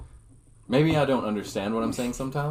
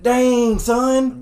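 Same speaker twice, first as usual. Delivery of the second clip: much higher, louder Southern drawl; Southern accent; 10 semitones higher, 6 dB louder than usual. Pretend. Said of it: "the Southern accent".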